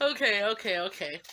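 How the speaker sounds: normal talking voice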